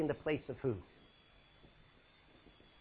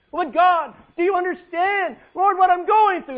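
high, loud complaining cry; anguished plea; 10 semitones higher, 10 dB louder than usual. Acted. A suffering believer pleading loudly with God. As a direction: beg to be understood.

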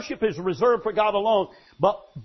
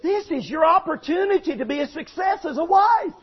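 high, loud preaching cry; full-force sermon shout; 9 semitones higher, 5 dB louder than usual. Real, not acted. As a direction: hammer it home from the pulpit.

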